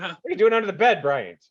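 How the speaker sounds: fast, high, loud surprise; startled question. Pretend. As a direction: blurt it out in shock. Imagined family discovering him.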